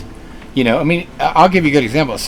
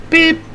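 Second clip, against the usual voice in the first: imitated car horn. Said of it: "Not a little".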